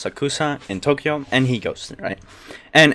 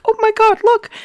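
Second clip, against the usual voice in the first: much higher squeal of delight; amazed child; 5 dB louder than usual. Retold or imitated. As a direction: squeal like a child seeing magic. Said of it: "it's like".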